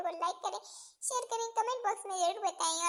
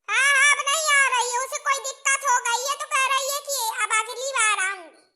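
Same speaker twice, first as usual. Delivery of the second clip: a higher, scolding mother-in-law voice; bossy mother-in-law; 5 semitones higher, 8 dB louder than usual. Acted. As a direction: lay down the law like a village mother-in-law.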